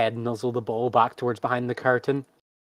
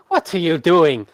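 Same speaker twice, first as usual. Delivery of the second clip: high, loud acted protest; alarmed ringmaster; 5 semitones higher, 9 dB louder than usual. Ringmaster sees the panther leap away, loud startled reprimand. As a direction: cry out indignantly at the animals.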